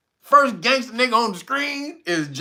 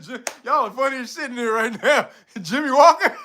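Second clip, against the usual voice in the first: laughing; 6 semitones higher than usual. Real, not acted. Laughing at chat's jokes.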